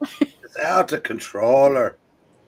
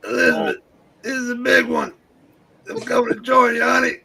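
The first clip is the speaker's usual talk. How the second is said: put-on dying old man; 13 semitones higher, much faster than usual. Superman heart attack, Sanford impression.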